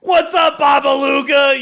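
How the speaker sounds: loud, high imitated hail; mock rowdy shout to an old pal; boisterous greeting. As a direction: holler a greeting like you know everyone. Imitates Mike hailing an old friend.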